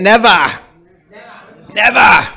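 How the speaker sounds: "Never" in a New England accent, r dropped. Shown as a demonstration. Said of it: "Or in the New England accent".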